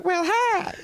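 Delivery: high, squeaky cat voice; "Well, hi." squeaked high like the cat; mock cat voice. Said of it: "you know".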